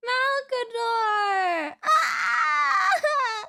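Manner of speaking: high, slow, tearful wail of the name; crying out. Real, not acted. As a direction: cry out the loss, half sobbing. Mourning a character's sacrifice.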